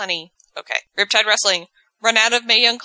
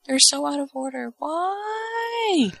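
baffled; 9 semitones higher than usual. Real, not acted. Confused by tweets out of order.

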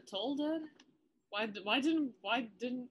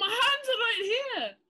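mock innocent; 11 semitones higher, 6 dB louder than usual. Character denying innocently.

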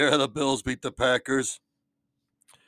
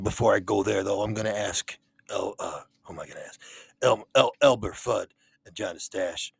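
normal talking voice

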